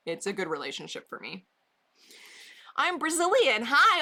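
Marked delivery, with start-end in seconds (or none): 2.8-4.0 high, cheery reading of a greeting; lightly voiced cheery viewer; bright chat read. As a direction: read it as a chirpy hello.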